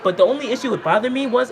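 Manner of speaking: ordinary delivery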